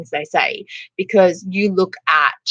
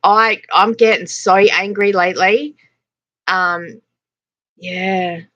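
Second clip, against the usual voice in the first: ordinary talk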